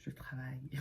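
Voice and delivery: hushed whispered aside; soft whispered joke. Real, not acted. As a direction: whisper it so nobody hears. Joking aside while working.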